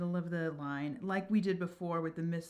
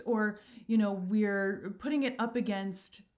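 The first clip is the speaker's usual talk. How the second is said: ordinary delivery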